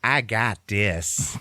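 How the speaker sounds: high start, slow swaggering finish; cocky reassurance. Retold or imitated. Marcus offers help with the keys, cocky. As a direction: play the overconfident suitor. Acted smitten man boasting.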